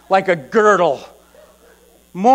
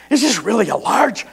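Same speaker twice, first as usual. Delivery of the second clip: normal talking voice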